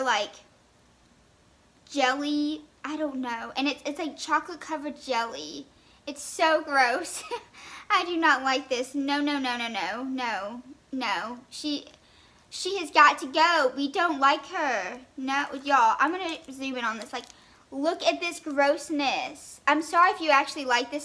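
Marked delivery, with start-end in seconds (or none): none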